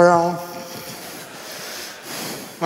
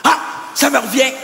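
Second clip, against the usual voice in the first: normal talking voice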